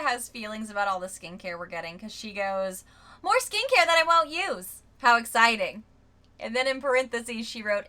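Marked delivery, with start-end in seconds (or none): 3.2-5.8 loud, higher mock excitement; sarcastic. Reading out a reviewer's gripe about skincare, sarcastic. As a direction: read it out loud with heavy sarcasm. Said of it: "Insert sarcasm here".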